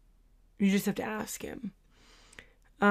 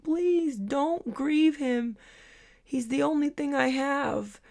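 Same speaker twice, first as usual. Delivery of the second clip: slow, higher tearful plea; retold crying plea; 7 semitones higher, slower than usual. Preacher crying to her audience, a gently acted tearful plea. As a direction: beg through mock tears. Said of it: "And she's crying".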